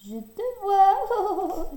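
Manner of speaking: high, slow sing-song teasing; playful. A narrator's reading. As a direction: coax the hiding cub. Playful call to cub.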